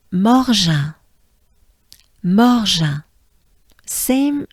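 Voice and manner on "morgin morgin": Morgin as mor-ZHAN, nasal end; French Morgin. Shown as a demonstration. Teacher models Morgin for learners. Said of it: "let's just go for it".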